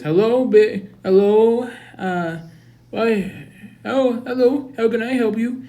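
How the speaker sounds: hammed-up principal on the phone; high principal voice. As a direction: answer the phone as a sing-song principal.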